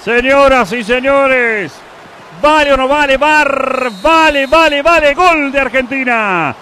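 loud, stretched goal call; goal celebration call. Acted. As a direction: announce the goal with full force. Goal call over music.

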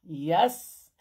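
usual speaking voice

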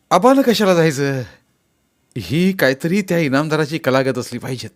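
loud, high-pitched outraged outburst; angry shouting. A narrator's reading. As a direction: burst out in protest, starting high and loud. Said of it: "असं म्हणून ओरडू लागला". (खवळून, चढ्या आवाजात ओरडत)

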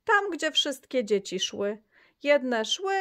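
ordinary delivery

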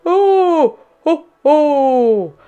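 high, loud startled ohs; drawn-out cries of surprise; Santa Claus. A narrator's reading. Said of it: "Santa gasps".

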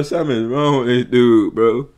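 ordinary talk

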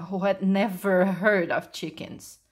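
usual speaking voice

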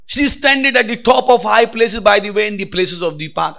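loud, high scripture reading; preaching scripture. Acted. Preacher proclaiming the verse to the church, loud and high.

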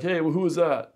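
imitating challenge. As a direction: call out suspiciously, as a retold interruption.

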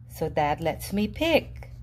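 ordinary delivery

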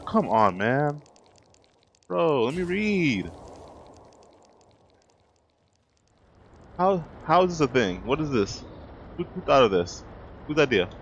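high, loud exasperated outcry; who thought of this, in disbelief; exasperated shock. Real, not acted. Gamer interrupted while reading, shouting in exasperated disbelief.

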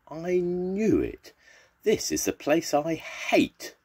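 high, drawn-out dread; storybook cat dreading this place; dismayed cat's thought. A narrator's reading. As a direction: stretch it out, high and aggrieved.

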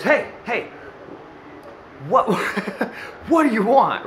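impatient dad, only half-serious; a little stern. Retold or imitated. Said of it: "A little, little stern".

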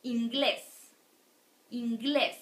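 "Inglés" with a strong final "glés"; last syllable stressed. Shown as a demonstration. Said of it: "the strong one falls in the last syllable".

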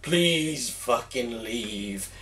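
low, very slow, drawn-out plea; weary plea. Retold or imitated. Store clerk facing a babbling drunk, wearily pleading. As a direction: drag it out, wishing the drunk gone. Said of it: "looking at me like".

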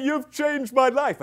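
high, slow, loud gush; overwrought mock cry. Woman telling her coach of her breakthrough, hammed-up emotional cry. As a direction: imitate her overwhelmed gratitude. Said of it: "she was beginning to cry".

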